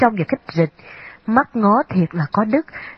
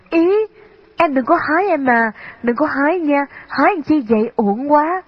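mild, higher-pitched exclamation of dismay; crying out in protest; 6 semitones higher than usual. A narrator's reading. Sister stopping her brother picking flowers, mildly crying out. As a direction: exclaim in a raised pitch, without real shouting. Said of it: "Thu Hà la lên".

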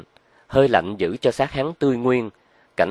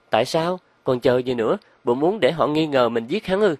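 higher-pitched woman's questioning voice; anxious woman accomplice; 5 semitones higher than usual. Acted. Loan questions her partner about calling police, impatient. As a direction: press him with rising, impatient questions.

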